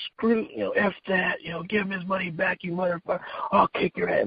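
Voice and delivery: high-pitched mock chewing-out; retold angry telling-off. Manny chewing out the driver who overcharged the kid. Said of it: "he jumped on his shit".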